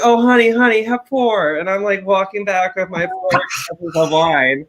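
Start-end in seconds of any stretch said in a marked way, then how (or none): 0.0-1.5 high, loud, gushing attendant voice; indulgent flight attendants. Retold or imitated. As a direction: play doting crew, pitched up and emphatic.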